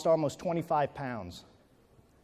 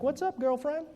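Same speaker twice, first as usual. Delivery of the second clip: playful; 8 semitones higher, 4 dB quieter than usual. Retold or imitated. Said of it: "I'm like".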